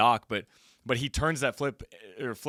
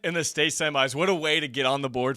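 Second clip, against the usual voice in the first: excited; 6 semitones higher, 13 dB louder than usual. Real, not acted. Shouting celebration.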